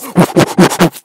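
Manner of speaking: sudden loud laugh; burst of laughter. Real, not acted. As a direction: laugh out loud, caught off guard. Reacts laughing to intro.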